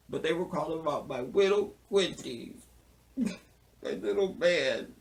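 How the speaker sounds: high, babyish mock grief; mock grieving. Mourning my little Quincy, mock tearful. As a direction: coo over him as if about to cry.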